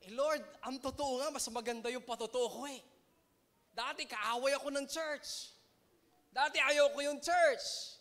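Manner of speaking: Pablo pleading. Retold or imitated. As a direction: argue your case to God, preacher voicing a convert. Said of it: "Ang sabi naman ni Pablo".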